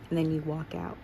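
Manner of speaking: plain reading voice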